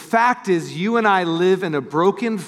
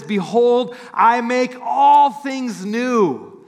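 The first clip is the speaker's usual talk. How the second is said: slow, higher, gentle proclamation; solemn, tender; 6 semitones higher, much slower than usual. Retold or imitated. Jesus promising the broken renewal, slow and tender. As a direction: speak it slowly as a loving promise. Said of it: "He says".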